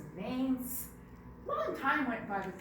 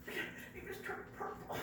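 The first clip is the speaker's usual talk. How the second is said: soft, slow strained croak; strained squeezed voice; 8 semitones higher, 7 dB quieter, slower than usual. Acted. Rhino straining in the tight fur, squeezed. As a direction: squeeze out words while straining.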